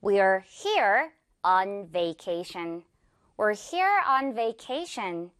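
very slow, word by word; slow model sentence. Shown as a demonstration. Teacher modeling example for learners, slow and clear. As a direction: draw out each word, careful v in "vacation". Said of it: "이렇게 표현을 합니다".

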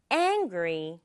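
"angry" with a strong opening A; stress on first syllable. Shown as a demonstration. Teacher repeating for the class, stress on the A of "angry". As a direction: hit the A in "angry", drop the second syllable. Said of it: "아 부분이 강조네요".

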